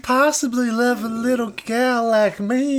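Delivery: high, slow syrupy Southern drawl; Southern belle. Retold or imitated. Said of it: "A little old Southern belle?".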